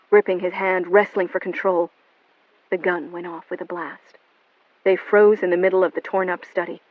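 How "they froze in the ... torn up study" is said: ordinary delivery, nothing marked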